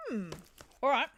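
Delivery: normal talking voice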